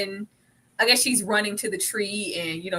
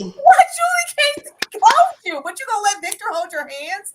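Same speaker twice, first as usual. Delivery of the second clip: much higher, louder indignant disbelief; incredulous; 11 semitones higher, 8 dB louder than usual. Real, not acted. Ranting to cohosts about Tabitha's choice, high indignant disbelief. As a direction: call her out in shock.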